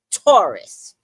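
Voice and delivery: loud, punchy announcement of the sign; dramatic announcement. Acted. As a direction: call out the sign like a trailer voice.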